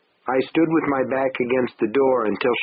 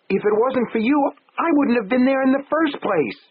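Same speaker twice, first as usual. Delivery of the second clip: shrill retort; 13 semitones higher than usual. Acted. Wife shoots back at husband.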